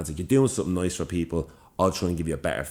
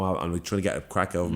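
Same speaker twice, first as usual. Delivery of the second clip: ordinary talk, nothing marked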